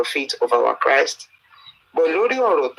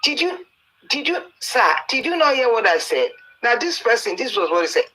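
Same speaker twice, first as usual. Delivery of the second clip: much higher, incredulous scolding voice; indignant mentor; 8 semitones higher than usual. Retold or imitated. Mentor scolding young Adeboye for not obeying, in a much higher incredulous voice. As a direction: play the mentor demanding if he was heard. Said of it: "Wọ́n náà ní".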